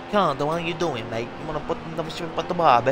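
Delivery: hammy fake Aussie accent, fast; a bad Aussie impression; bad Australian accent. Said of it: "a bad Australian accent".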